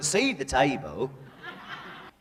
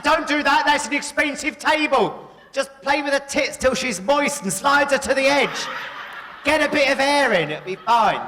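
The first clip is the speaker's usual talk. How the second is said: higher and louder mock-urgent advice; hammed-up raised voice; loud retelling; 9 semitones higher, 3 dB louder than usual. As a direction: call it out high and loud. Retold advice, shouted.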